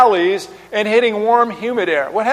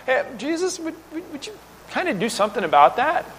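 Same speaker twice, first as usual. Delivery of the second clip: higher, softer, hesitant mock-polite plea; nice, polite request; 6 semitones higher, 4 dB quieter than usual. Disciples asking Jesus nicely for help, meek and polite. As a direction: ask timidly and nicely, stumbling into it. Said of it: "niceness to ask me".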